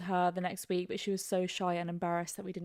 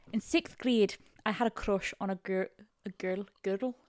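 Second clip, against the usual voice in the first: attempted Scottish reading voice; Scottish accent. Co-host reading a crush story, Scottish accent. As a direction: read it out in Scottish. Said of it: "I wanna do one in Scottish".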